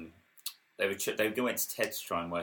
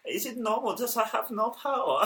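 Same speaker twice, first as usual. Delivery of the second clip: high-pitched German accent impression; 12 semitones higher, 4 dB louder than usual. Quoting Vettel's start-lap radio, in a German accent. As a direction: put on a German driver's puzzled radio voice. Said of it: "should I do a German accent?".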